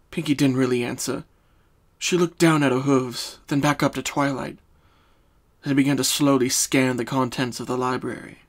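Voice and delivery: plain reading voice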